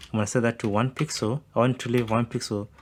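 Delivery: ordinary delivery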